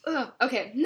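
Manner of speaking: normal talking voice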